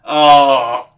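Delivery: loud mock crowd gasp; crowd's big oh. Retelling the theatre crowd reacting, loud oh. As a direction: belt out the audience's delighted oh. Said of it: "and everyone just went".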